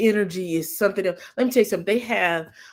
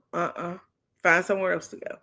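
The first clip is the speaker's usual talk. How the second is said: ordinary talk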